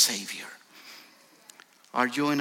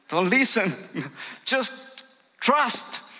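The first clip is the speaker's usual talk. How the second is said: much higher, louder, coaxing the father to trust; urging Jesus voice; 10 semitones higher, 4 dB louder, slower than usual. Acted. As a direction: lift it up high and urge him on. Jesus answers the grieving father.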